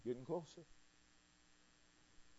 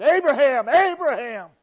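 loud, high call from heaven; urgent call; 10 semitones higher, 15 dB louder than usual. Acted. God stopping a determined Abraham, urgent shouted call. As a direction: call the name out urgently, twice, much louder. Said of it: "he didn't just say ... he said".

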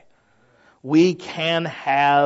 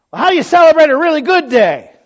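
excited question; 10 semitones higher, 9 dB louder than usual. Acted. Preacher voices an imagined questioner.

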